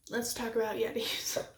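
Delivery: hushed whisper, breath only. Acted. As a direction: whisper it like a secret. Dramatic aside about Yetis.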